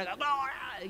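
high-pitched mock yell, kept soft; grito imitado. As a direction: imitate a disruptive shouter at half volume. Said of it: "no vas a venir a gritar". (grito fingido, agudo pero contenido)

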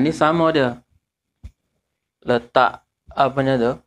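ordinary talk, nothing marked

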